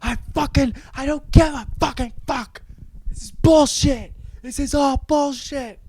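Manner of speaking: hammed-up drunk complaint; mock whiny rant. Ben Affleck ranting.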